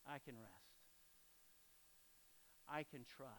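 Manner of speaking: soft, low, sighing release; relieved sigh. Acted. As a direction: breathe out and let it settle, very quietly. Acts out relieved rest in God's love.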